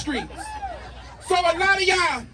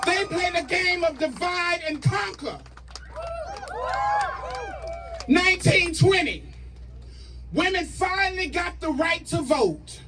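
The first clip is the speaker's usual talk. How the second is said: rally speech; 3 semitones higher than usual. Acted. Rally call to action, shouted.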